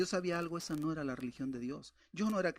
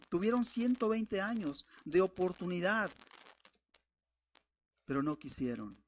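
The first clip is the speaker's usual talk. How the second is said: ordinary talk, nothing marked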